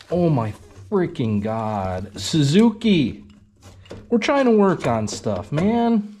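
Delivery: slow, loud, rising frustration; exasperated complaint. Real, not acted. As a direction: drag out the words, then complain high and loud. Cylinder head will not come off.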